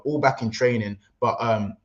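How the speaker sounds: ordinary talk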